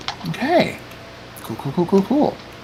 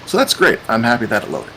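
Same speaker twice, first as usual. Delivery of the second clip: ordinary talk, nothing marked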